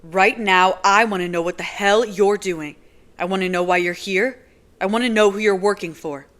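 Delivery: loud, hammering repeated demands; loud furious interrogation. Acted. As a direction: pound out every want. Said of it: "shaking with fury".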